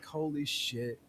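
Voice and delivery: very soft, stunned aside; hushed realization. Retold or imitated. Student looking up and recognizing his seat neighbor, hushed. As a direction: let it sink in under your breath.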